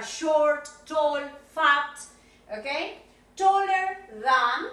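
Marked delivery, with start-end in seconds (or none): 3.4-4.7 taller than, drawn out slowly and loud; teacher modelling a comparative; slow stressed comparative. Shown as a demonstration.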